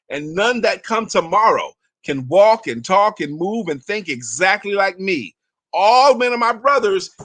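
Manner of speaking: slow, rising declamation of the scroll; inspirational reading. Acted. Leader reading the scroll aloud to the group, declaiming proudly. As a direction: read it aloud like a proud creed.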